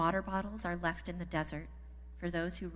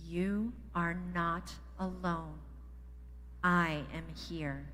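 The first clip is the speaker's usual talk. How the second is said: slow group recital; slower than usual. Shown as a demonstration. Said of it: "Can you guys say it with me?".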